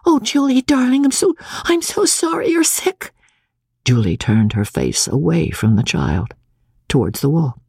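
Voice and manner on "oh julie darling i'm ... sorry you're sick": put-on high child's voice, sorry and tender; all contrition. A narrator's reading. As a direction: apologise like a remorseful little girl. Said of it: "all contrition".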